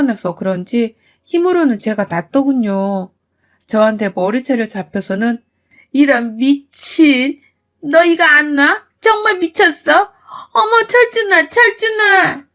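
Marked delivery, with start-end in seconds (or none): 5.9-12.5 shrieking mother-in-law. Acted. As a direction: yelp for help with hair being pulled. 머리채 잡힌 시어머니가 비명. (아들을 부르며 훨씬 높게 비명 지르는 시어머니)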